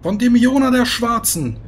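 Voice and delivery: booming voice over the battlefield; magically amplified voice. Acted. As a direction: proclaim it across the whole army. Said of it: "eine magisch verstärkte Stimme klingt".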